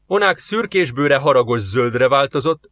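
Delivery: ordinary delivery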